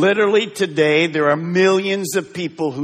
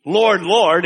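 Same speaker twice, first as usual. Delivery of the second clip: "Lord, Lord" said louder, each word hit; emphasis on Lord, Lord; 3 semitones higher, 7 dB louder than usual. Shown as a demonstration. Preacher reading Jesus' words to the congregation, with emphasis on "Lord, Lord". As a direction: lean hard on "Lord, Lord".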